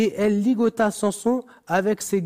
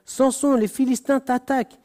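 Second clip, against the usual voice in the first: higher, urgent call of alarm; cried out; 5 semitones higher than usual. A narrator's reading. Dalila crying out to wake Samson, urgent call. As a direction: call out the warning, pitched up. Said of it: "Puis elle s'écria".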